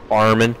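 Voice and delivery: "Farming" said as farmin' with the final g dropped; country farmin' without the g. Shown as a demonstration. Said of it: "I already dropped the G".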